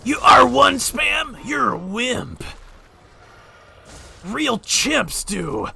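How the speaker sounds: slow, high taunting sneer; mocking voice. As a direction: jeer at him like a bully. Mocking an imagined R1 spammer.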